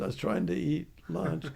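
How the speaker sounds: usual speaking voice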